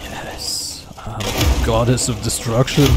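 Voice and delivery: booming mock villain boast; sneering wrestling villain. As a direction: gloat like a wrestling heel.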